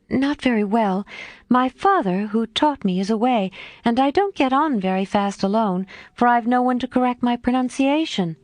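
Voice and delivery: a hint of bashful young woman; shy young Meg. A narrator's reading.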